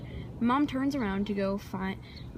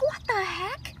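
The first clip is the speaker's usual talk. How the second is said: shocked what the heck; 11 semitones higher, slower than usual. Retold or imitated. Shocked at the husky appearing.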